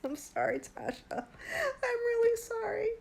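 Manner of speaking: can't stop laughing while apologizing; laughing apology. Real, not acted. Tipsy streamer giggling an apology to Tasha. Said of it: "I don't mean to laugh".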